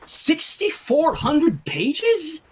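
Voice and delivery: stunned slow question at a giant book; incredulous. Acted. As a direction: drag it out in disbelief. Finding huge dictionary.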